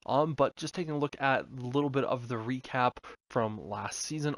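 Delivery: ordinary talk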